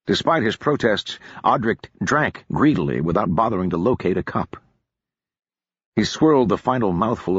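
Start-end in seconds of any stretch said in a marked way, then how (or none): none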